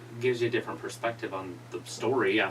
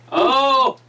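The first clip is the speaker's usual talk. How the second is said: surprised shout; 12 dB louder than usual. Real, not acted. Reacting to wrestling move.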